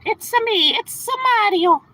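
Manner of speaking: very high, loud cartoon game-hero impression; Mario. Streamer repeating his impression for viewers, playful Mario voice. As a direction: belt out a high-pitched video game catchphrase. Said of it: "that was my Mario impression".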